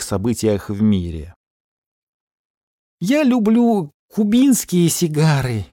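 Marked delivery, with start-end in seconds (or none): 3.0-5.7 high, slow, cigar clamped in teeth; cigar in mouth. Acted. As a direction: talk around a cigar in the mouth. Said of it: "не вынимая сигары изо рта".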